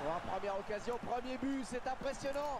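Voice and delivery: high, thrilled commentator voice; excited sports commentary. Acted. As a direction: marvel at the first goal. Commentator after goal.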